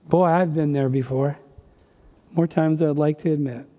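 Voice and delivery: ordinary talk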